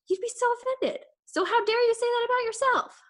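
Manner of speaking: high, passionate challenge; indignant. Real, not acted. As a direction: push them up in pitch, fired up. Indignant pep talk to listeners.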